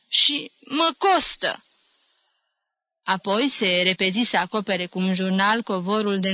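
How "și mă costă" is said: slow hurt reproach; offended. A narrator's reading. Old aunt reproaching nephew, slow and hurt. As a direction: drag it out, aggrieved. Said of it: "adăugă ea jignită".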